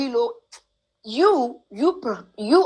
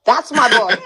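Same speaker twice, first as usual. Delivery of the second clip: proud claim breaking into a laugh; laughing pride; 7 semitones higher than usual. Retold or imitated. Telling the strangers at the concert she knows him, laughing proudly. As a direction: insist on it, laughing. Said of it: "I said".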